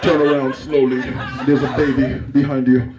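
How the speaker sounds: low, menacing monster voice; deep creature voice, drawing out the warning; Venom. Acted. Womb warns woman of baby behind her, deep Venom voice. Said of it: "a woman's womb sounds like Venom".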